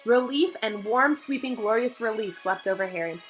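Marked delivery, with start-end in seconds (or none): none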